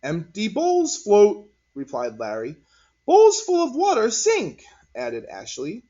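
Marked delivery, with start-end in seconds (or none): 0.0-1.5 put-on higher, slow kid answer; a boy reporting a find; child voice. A narrator's reading. 3.1-4.6 put-on high, loud kid adding; a girl chiming in; child voice. A narrator's reading. Child adds.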